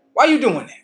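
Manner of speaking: loud, high outburst; mock confrontation. Confronting the man in imagination.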